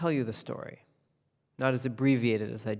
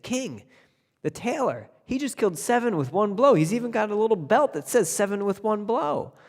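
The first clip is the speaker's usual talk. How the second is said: much higher, breathless excitement; excited report; 8 semitones higher than usual. Acted. Townspeople rushing to tell the king, excited and high.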